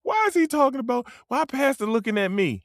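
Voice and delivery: high-pitched put-on complaint; mock indignant churchgoer. Churchgoer complaining about the pastor's sermon, mock indignant. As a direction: imitate an affronted member of the congregation.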